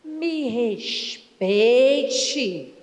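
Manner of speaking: slow, drawn-out offended wife; Me respeite, slow and haughty; stern wife. Acted. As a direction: stretch it out like a prim wife. Wife rebuffing husband's teasing.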